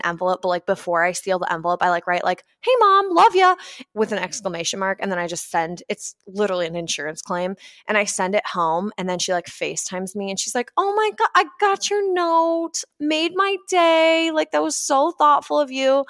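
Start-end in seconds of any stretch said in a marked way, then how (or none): 2.6-3.6 high, loud and cheery; cheery note. Retold or imitated. Writing a note to mom, high loud cheer. 10.8-16.1 a mother thrilled by a tiny note; gushing mom. Retold or imitated. Mom on FaceTime thanking her kid, high gush.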